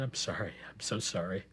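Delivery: soft, low, broken apology; soft remorseful apology. Acted. Father says sorry to crying daughter behind locked door, softly remorseful. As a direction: apologize quietly, voice sinking with remorse.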